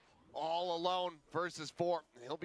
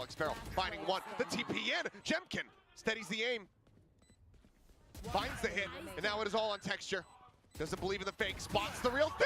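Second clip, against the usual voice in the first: rising, higher, building to a shout; esports caster; 7 semitones higher, 4 dB louder than usual. Acted. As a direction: call the clutch with mounting hype. Clutch play-by-play building up.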